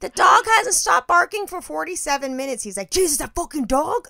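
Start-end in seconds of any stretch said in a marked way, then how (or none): 0.0-2.6 exasperated complaint. Retold or imitated. Complaining to husband. 2.9-4.1 husband's exasperation. Retold or imitated. Husband's reply voiced.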